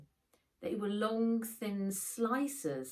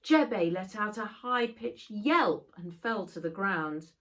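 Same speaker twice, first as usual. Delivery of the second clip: ordinary delivery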